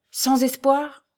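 high-pitched, incredulous plea; dismayed question. A narrator's reading. Young man begs the doctor for any hope, dismayed. Raoul questions the fatal verdict.

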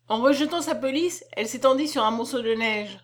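usual speaking voice

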